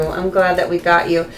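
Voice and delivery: normal talking voice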